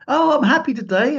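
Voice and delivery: high, loud, sing-song imitation; happy chirp. Friend announcing her good mood, high and chirpy. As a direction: chirp it like a carefree rich lady. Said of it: "she said".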